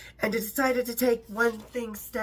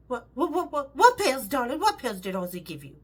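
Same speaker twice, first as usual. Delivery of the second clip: put-on stammering English accent, rising worry; imitating a flustered English accent; alarmed English lady. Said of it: "tiny little English voice".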